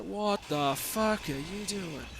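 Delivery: slow, drawn-out disbelief; mock bewildered homeowner, slow; baffled owner. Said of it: "the owner of the house is just like".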